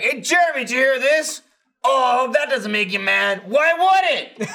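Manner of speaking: high, loud teasing put-on voice; mock Jeff. Imitating Jeff baiting Jeremy, high and teasing. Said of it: "Jeff will be like".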